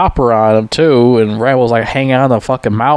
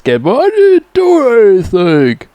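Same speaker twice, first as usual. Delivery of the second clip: slow, high, loud whine; whiny high Rambo; 11 semitones higher, 3 dB louder than usual. Retold or imitated. Rambo pleads to the cops, slow high whine. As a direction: drag out a childish plea. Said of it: "And he says".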